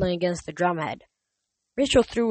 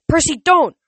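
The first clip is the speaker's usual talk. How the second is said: high, much louder warning; urgent shout; 10 semitones higher, 9 dB louder than usual. Acted. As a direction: cry out to stop him. Rachel warns Percy urgently.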